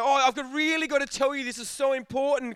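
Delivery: mock urgent caller. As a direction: insist it's important over a bad line. Caller on bad line.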